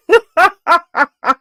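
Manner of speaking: high, loud burst of laughter; amused laugh. Real, not acted. Laughing with viewers over a shared annoyance, amused. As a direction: laugh out loud in a quick run.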